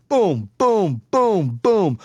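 high, punchy booms; punch sound effects. Retold or imitated. Father re-enacting his son's punches, high punchy booms. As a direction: land each boom like a blow.

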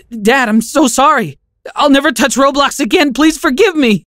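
high, loud, desperate pleading; begging. Acted. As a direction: beg him as if everything depends on it. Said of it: "I begged".

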